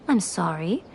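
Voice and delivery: soft chuckle then a sorry; amused, not really sorry; laughing apology. Acted. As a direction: laugh it off quietly. Fox lady laughs off scolding.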